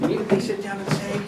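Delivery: quick whisper, barely audible; hushed teacher whisper. Shown as a demonstration. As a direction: whisper the instruction to the class. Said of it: "let's do this with a whisper voice".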